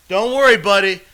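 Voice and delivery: loud, high, falsely cheering promise; mock reassurance. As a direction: call it out to a stranger from the car. Joke shouted to homeless man.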